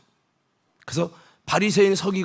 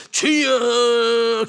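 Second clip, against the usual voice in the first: long drawn-out wailing 주여; showy prayer cry; 5 semitones higher, 3 dB louder, much slower than usual. Retold or imitated. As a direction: moan it out for show. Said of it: "기도하다가 사람 없으면". (과시하듯 길게 늘인 주여 외침)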